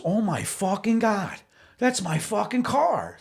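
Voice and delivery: high, drawn-out mock disbelief; slow, high-pitched exclamation of shock; acted astonishment. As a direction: imitate a friend stunned by a sudden sighting. Friend spots his stolen car.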